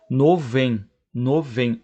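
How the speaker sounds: "no vem" as novo em fused into two syllables; dropped o. Shown as a demonstration. Teacher demonstrating elision, "no vem" with the final o of novo dropped. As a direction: swallow the o, giving spaced "no, vem". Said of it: "O o nem é pronunciado".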